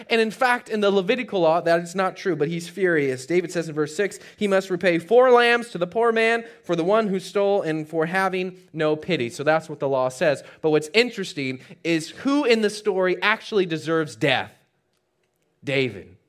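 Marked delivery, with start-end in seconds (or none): none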